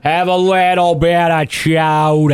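silly drawn-out voice. Acted.

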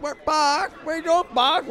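high whiny imitation. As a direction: whine it back high. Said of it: "With that whiny voice".

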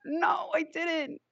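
high, slow, drawn-out whine; whiny protest. Retold or imitated. To the cops in the front seat, a slow high whine. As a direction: whine it like an innocent kid.

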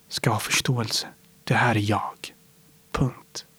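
soft whisper, final and firm; whispered conclusion. Real, not acted. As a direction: whisper the last word down. Closing personal manifesto. (viskande avslutning)

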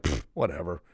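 low, soft, brushing-off pfft; mock dismissive brush-off; dismissive scoff. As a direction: shrug it off like it's nothing. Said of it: "They're just gonna like".

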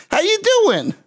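very high puppet laugh and greeting; cackle, then greet in a high puppet voice; Randy. Acted. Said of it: "it's Randy".

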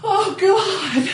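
high laughing outburst; laughing shriek. Real, not acted. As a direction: laugh it out loud. Laughing with mouthful of tea.

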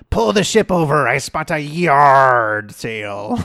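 slow, drawn-out comic pirate. Acted. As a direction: bellow it like a pirate, stretching the punchline.

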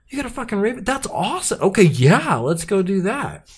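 a hint of a breathless girl bragging; lightly voiced excited Sansa.

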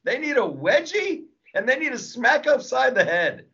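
high, gleeful; laughing rant. Real, not acted. Comic demanding wedgies for the woke, high and gleeful. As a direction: laugh through it, revved up.

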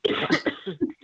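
husky, catching throat; clearing a frog from the throat. Real, not acted. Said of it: "I got a frog in my throat".

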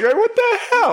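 high squeak of mock outrage; a dad's high squeak at a stolen kiss; squeaky what the hell. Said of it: "I'm just sitting there like".

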